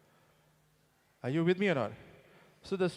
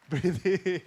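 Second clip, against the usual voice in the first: laughing instruction; 3 dB louder than usual. Retold or imitated. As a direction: breathe in said through a laugh. Son coaching with a laugh.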